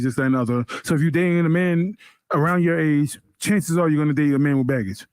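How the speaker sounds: ordinary delivery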